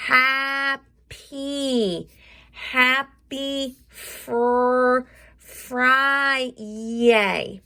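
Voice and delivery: "happy fr-" stretched, Friday built up sound by sound; sounding out a speech bubble. Shown as a demonstration. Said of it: "sound out what I'm saying".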